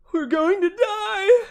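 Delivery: high, loud, slow scream of panic; terrified passenger shrieking; panicked yell. Acted. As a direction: wail it like you're about to die.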